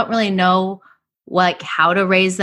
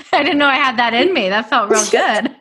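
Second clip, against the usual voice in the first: laughing; 5 semitones higher than usual. Real, not acted. After making funny faces.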